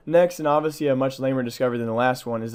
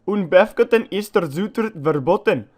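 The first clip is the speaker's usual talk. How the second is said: loud, higher, hammed-up German accent; 6 semitones higher, 7 dB louder than usual. Reading a German warning sign to viewers, loud mock German accent. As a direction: bark the sign out like a German guard, loud and high. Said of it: "sorry for my terrible German accent".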